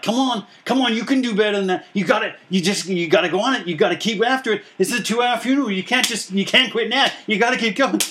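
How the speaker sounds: high, pushy cheering coach voice; egging her on like a coach from the sideline. Retold or imitated. Funeral director eggs on wailing mourner, high coach cheer. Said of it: "it's like".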